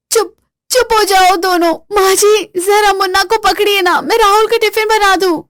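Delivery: high, strained frantic pleading; overwhelmed mother begging for help; frazzled daughter-in-law calling to mother-in-law. Acted. Kaveri frantic with crying kids.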